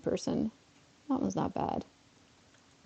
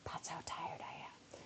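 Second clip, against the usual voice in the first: barely audible whispered aside; tired whisper; 22 dB quieter than usual. Real, not acted. Streamer admits being tired after six hours, whispered. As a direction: whisper it as if worn out.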